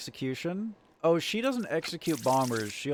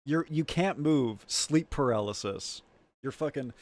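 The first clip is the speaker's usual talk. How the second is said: usual speaking voice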